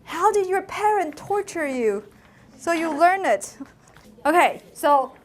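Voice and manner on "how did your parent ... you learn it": hammed-up disbelief at a hard language; amazed listeners. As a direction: gush like an amazed outsider. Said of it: "they're like".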